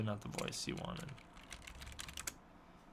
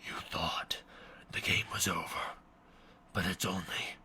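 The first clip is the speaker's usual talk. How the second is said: low, whispered villain voice; menacing low whisper with pauses; Jigsaw; 8 semitones lower, 5 dB quieter than usual. Shown as a demonstration. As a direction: copy a horror villain, hushed and very low. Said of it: "Let's hear what he sounds like".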